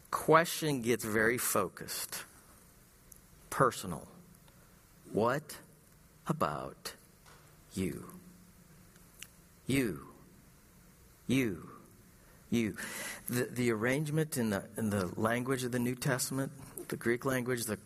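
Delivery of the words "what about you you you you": slow, soft, each YOU set apart; hushed, searching emphasis on you; emphatic you. Shown as a demonstration. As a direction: press the word you again and again. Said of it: "You is very emphatic".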